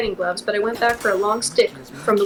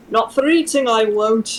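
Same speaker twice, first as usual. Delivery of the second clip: Scottish accent; 4 dB louder than usual. Shown as a demonstration. Reader redoing Angus's line in Scottish accent. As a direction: redo the line with a Scottish lilt. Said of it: "in the Scottish accent".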